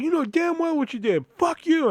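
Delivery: high-pitched, indignant retort; retold angry snap. Arguing with her after he snapped, retold high angry retort. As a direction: fire it back with pitch way up. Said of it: "I snapped".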